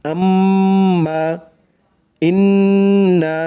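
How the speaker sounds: আম্মা, ইন্না with the doubled m and n held and hummed through the nose; nasal ghunnah. Shown as a demonstration. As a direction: hum the doubled consonant long. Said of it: "গুনগুন করে গুন্নাহ করতে হবে".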